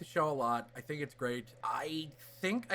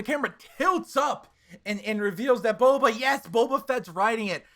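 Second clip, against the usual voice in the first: hyped reveal; 7 semitones higher, 7 dB louder than usual. Retold or imitated.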